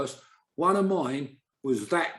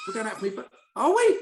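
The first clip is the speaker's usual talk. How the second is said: high, laughing question; laughing disbelief; 3 dB louder than usual. Retold or imitated. Manager's wife to player, laughing in disbelief. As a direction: squeak it out through a laugh. Retold quote breaking into laughter.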